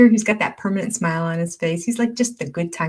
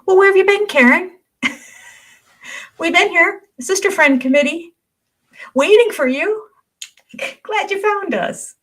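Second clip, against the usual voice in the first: much higher, sing-song teasing welcome; playful mock questioning; 12 semitones higher, 5 dB louder than usual. Host teasing a new viewer who just found the channel, high and playful. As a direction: pitch it way up, like teasing a late arrival.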